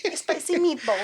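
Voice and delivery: high put-on Italian accent; mock Italian. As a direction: ham up the meatball line. Italian cartoon riff.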